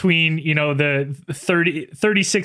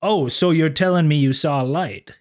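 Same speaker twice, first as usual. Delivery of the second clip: smooth officer voice. Retold or imitated. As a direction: play a humble, courtly lawman. Sisko questioning the witness.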